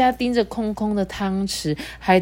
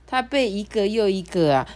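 ordinary delivery, nothing marked

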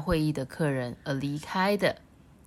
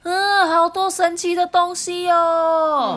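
awed frog; 12 semitones higher, 8 dB louder, slower than usual. Acted. Frogs marvel at house.

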